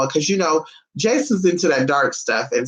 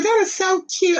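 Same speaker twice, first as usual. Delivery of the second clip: squeaky high gush; 14 semitones higher than usual. Retold or imitated. Retelling meeting the tiny dog, gushing high. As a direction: gush like you just saw a puppy. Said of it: "I was just like".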